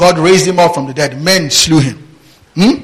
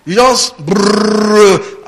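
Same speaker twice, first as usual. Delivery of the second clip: mock rote babble; 5 semitones higher, much slower than usual. Mimics mindless rote recitation.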